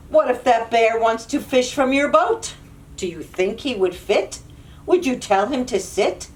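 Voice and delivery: ordinary delivery, nothing marked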